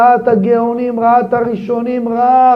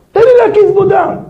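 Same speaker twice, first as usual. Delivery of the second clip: much higher and louder plea; Abraham pleading with God in a raised voice; pleading cry; 10 semitones higher, 6 dB louder than usual. Retold or imitated. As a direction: beg to be allowed to do something. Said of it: "אתה יודע מה הוא אמר?".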